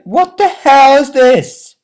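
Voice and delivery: slow, high, loud disbelief; baffled. Retold or imitated. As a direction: stretch it out in disbelief. Exasperated at bizarre film.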